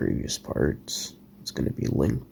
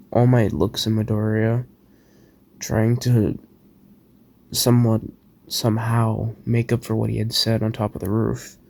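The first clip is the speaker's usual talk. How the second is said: louder, steady storytelling voice; story narration; 5 semitones higher, 4 dB louder than usual. A narrator's reading. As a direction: narrate the fan fiction with drive. Reads his fan story aloud.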